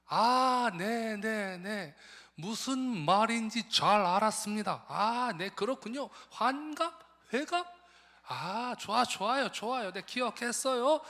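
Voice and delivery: high, loud and eager, fumbling the words; a missionary nodding along to Korean he only half follows; foreign missionary's broken Korean. Retold or imitated. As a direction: play a cheerful foreigner struggling with Korean. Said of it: "한국말을 잘 못하시니까".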